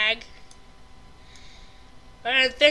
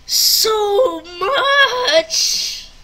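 high, loud wailing; loud, high cry of despair; drawn-out wail; 8 semitones higher, 11 dB louder than usual. Real, not acted. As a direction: wail each word long and loud. Lamenting the loss.

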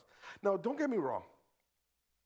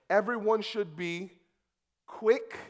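scripture read; 4 semitones higher, 5 dB louder than usual. Acted. Preacher reading scripture aloud.

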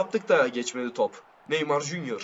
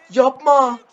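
loud; 8 semitones higher, 11 dB louder than usual. Real, not acted.

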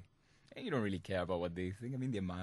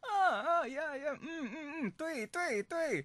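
much higher, eager yeahs and mms; happy mm-hmms; slower than usual. Shown as a demonstration. Prankster egging on a chatty wrong-number caller, high eager noises. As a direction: echo his happy tone with bright little sounds. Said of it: "just go".

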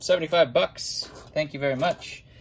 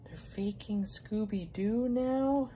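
high, slow, incredulous question; disbelieving; 8 semitones higher, 6 dB quieter, slower than usual. Real, not acted. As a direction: ask it like you can't believe it. Repeats in disbelief.

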